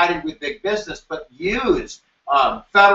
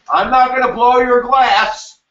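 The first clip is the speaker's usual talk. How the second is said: louder, slow mock threat; mock skilled worker; 7 semitones higher, 6 dB louder than usual. Teacher voicing a striking glassblower, slow and louder. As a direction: declare it like a proud craftsman. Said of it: "So if I say".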